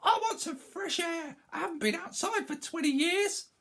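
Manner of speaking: put-on very high scoffing old woman; defiant crone shout. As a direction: toss it off like a wild granny. Grandma figure voiced.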